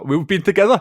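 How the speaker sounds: "together" pushed up high and loud; mock-scandalised repeat. Real, not acted. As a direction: echo "together" as if it's a juicy admission. Joking repeat of awkward slip.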